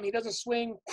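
ordinary delivery, nothing marked

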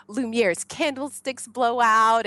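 usual speaking voice

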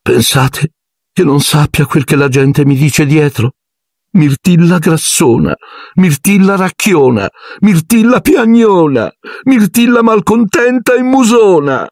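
shrill-pitched self-pitying ghost lament; wounded wailing outburst. A narrator's reading. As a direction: wail out the list of cruel nicknames. Myrtle's hurt, wailing outburst.

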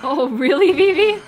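loud, high amazed reaction; loud high mock shock; surprised. Real, not acted. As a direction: exclaim in disbelief. Reacting to chat.

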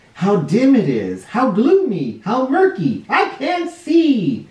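growing dread as it gets murkier; fearful, climbing pitch into slow frightened words; scared in the dark. A narrator's reading. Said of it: "It's so dark in here".

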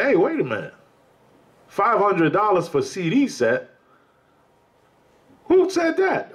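incredulous church member. Retold or imitated. Congregant hearing of the costly CD set, acting indignant. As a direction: play the member who can't believe it.

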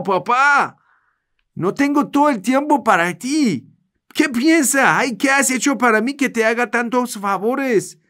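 high, whiny, fed-up grumbling; resentful complaining. Acted. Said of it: "la vas a expresar tu disgusto".

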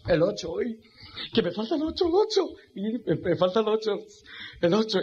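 near-hysterical begging for one number; desperate gambler. Acted. Gambler on three cards still missing the eight, high desperate squeals.

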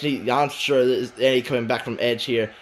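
ordinary talk

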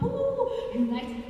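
high, slow, swooning ooh; stretched high delighted ooh; delighted nostalgia. Real, not acted. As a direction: savour the joy of unity. Nostalgic 1995 memory.

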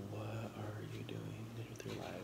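whispered playful jab. Real, not acted. Vlogger whispers a joke to dumpling-haters. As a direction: lean in and whisper it. Joking aside to viewers.

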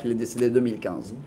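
usual speaking voice

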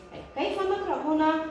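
ordinary delivery

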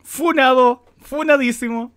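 loud teasing verdict; loud exclamation. Real, not acted. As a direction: call it out loud and playful. Streamer's loud joking verdict.

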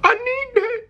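very high, whimpering wail; overwhelmed craving. Real, not acted. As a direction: plead in a high, helpless whine. Overcome by slow-motion food footage.

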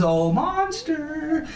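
high, slow, swooning call; swooning bride call. Pretend. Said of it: "And then he says".

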